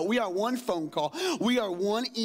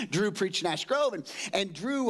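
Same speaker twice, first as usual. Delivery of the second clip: usual speaking voice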